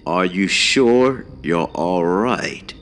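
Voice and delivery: slow, drawn-out officer's question; doubtful officer. A narrator's reading. Officer doubts the runner's sanity, slowly questioning. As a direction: stretch it out with gentle doubt. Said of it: "the officer asked me".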